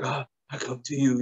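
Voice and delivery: mock soft, gentle Jesus voice; soft pretty Jesus. Mocking the TV show Jesus, soft and gentle. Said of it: "the soft, pretty Jesus".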